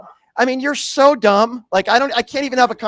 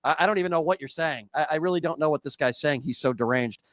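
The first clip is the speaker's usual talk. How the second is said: much softer and lower, muttered disbelief; baffled aside; 5 semitones lower, 9 dB quieter than usual. Real, not acted. Host quietly baffled by the chanting caller, soft. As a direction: shake your head and say it under your breath.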